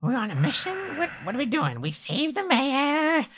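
ordinary delivery

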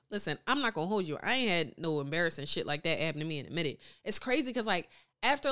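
normal talking voice

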